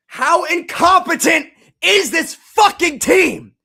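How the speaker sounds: slow, loud, high enraged yell; furious shout. Real, not acted. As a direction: drag it out in a full-volume scream. Fan furious at team.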